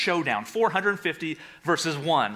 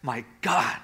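anguished cry; 6 dB quieter than usual. Acted. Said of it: "Jesus calls out".

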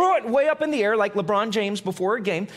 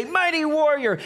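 mock-grand "Mighty warrior"; grand angel greeting; 7 semitones higher than usual. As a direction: hail him like a hero. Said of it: "Gideon hears the angel of the Lord say".

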